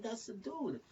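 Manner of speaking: normal talking voice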